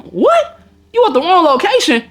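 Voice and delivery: high incredulous squeak on what; can't believe it; disbelief. Retold or imitated. Retelling his reaction to her being at the wrong place, incredulous.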